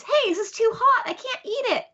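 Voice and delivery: high-pitched retold whine; mock complaining. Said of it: "and then he complains".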